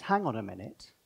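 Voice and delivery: hushed, slow, dawning wonder as Adam; Adam's quiet realisation. Retold or imitated. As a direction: let it dawn on him softly. And then he goes.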